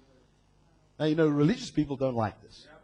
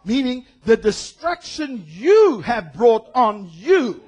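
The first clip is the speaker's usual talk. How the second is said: ordinary delivery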